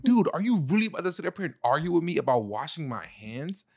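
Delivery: high, angry mock rant; retold anger. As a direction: vent like a furious partner being accused. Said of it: "I was so mad".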